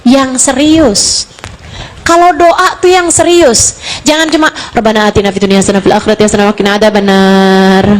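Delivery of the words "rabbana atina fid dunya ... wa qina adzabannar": rattled off fast, like a chore; rushed careless prayer. Shown as a demonstration. Rattling off a rote prayer to show it's not serious. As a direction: gabble the prayer without meaning it. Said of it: "Ini nih nggak serius doanya".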